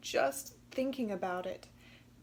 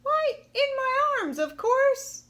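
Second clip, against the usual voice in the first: very high, loud chattering monkey; shrill-pitched matter-of-fact monkey mother; 9 dB louder than usual. Acted. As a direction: squeal it out like a monkey. Missus Monkey answers.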